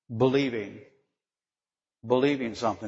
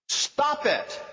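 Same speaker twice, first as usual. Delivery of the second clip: stern Messiah; 6 semitones higher than usual. Acted. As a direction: scold her firmly, like a stern judge. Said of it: "I don't think the Messiah's words were kind of like soft-spoken".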